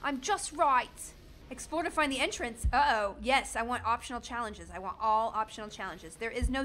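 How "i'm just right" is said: exaggerated British voice, stretched at the end; put-on British accent. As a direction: show off your fake British accent. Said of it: "Is there an accent skill?".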